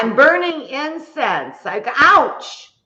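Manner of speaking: high, loud outburst; startled exclamation. Real, not acted. Exclaims about burning incense mishap.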